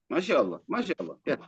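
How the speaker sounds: ordinary delivery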